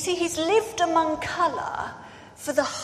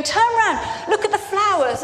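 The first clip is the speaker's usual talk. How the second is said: higher and louder, eager urging to turn around; excited calling; 7 semitones higher, 5 dB louder than usual. Retold or imitated. Family urging him to see colour, excited higher calling. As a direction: call out to him with rising excitement. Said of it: "and they're going".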